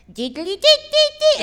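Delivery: very high, slow sing-song nonsense; silly high squeaky voice; funny noises. Retold or imitated. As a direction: warble like a weird child. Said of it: "make me funny noises".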